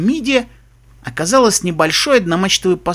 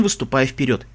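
ordinary delivery

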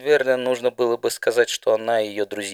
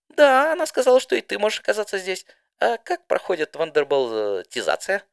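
high chirpy pony voice; 10 semitones higher, 4 dB louder than usual. Acted. Didi chats with Spitfire.

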